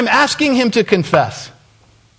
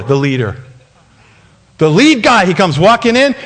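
usual speaking voice

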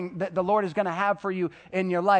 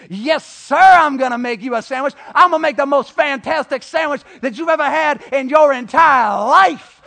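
much higher and louder, overeager shout; exaggerated eagerness; 7 semitones higher, 10 dB louder than usual. Son eagerly agreeing to make dad a sandwich, hammed-up shout. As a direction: ham up the keen obedient son. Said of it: "slightly exaggerating".

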